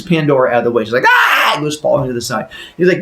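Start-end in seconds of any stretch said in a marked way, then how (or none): 1.1-1.5 high, loud mock scream; startled shriek. Wife pushed to the side, high startled shriek. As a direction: cry out as you get shoved. Pushed over, falls aside.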